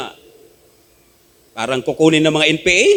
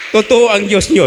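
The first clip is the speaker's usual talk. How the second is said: higher, louder proclamation of "Totoo ang Diyos niyo"; emphatic repeat; 6 semitones higher, 6 dB louder than usual. Retold or imitated. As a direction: declare it like a convert's confession. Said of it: "sabi niya". (malakas at mataas na pagpapahayag)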